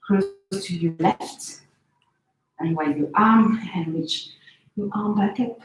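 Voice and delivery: usual speaking voice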